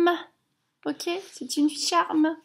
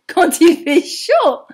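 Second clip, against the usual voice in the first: plus fort et plus aigu, plein d'entrain; avec enthousiasme; 4 semitones higher, 8 dB louder than usual. Shown as a demonstration. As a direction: relancer la phrase avec éclat pour les enfants. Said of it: "Oh, pardon". (version reprise, forte et enjouée)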